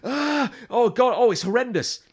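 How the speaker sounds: high, loud cry of agony, hammed up; mock screaming. Comedian imitates the vlogger stung by the worm, mock screaming. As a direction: imitate a man screaming in pain. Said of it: "he just, like, instantly starts screaming".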